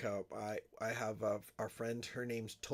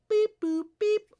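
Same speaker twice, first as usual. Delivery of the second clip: high bleeping noises; censor bleep; 13 dB louder, slower than usual. Pretend. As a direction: bleep out the swear word. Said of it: "Holy sh".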